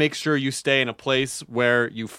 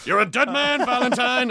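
mock movie threat; 8 semitones higher than usual. Quotes a Trading Places villain.

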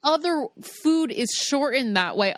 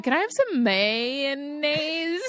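slow, higher, drawn-out mayonnaise; awkward retold order; 6 semitones higher, slower than usual. Woman ordering on an early date, awkward. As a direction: stretch the word out self-mockingly.

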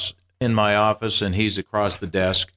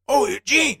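much higher, delighted Mel Brooks greeting; 14 semitones higher than usual. Retold or imitated. Mel Brooks spotting Eugene at breakfast, higher and delighted. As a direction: call out like spotting an old pal. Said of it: "and he's like".

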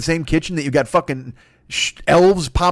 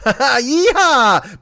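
cowboy yeehaw; 4 semitones higher than usual. Acted. Playing the cowboy snack cake.

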